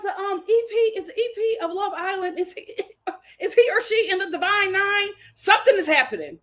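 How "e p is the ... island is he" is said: ordinary talk, nothing marked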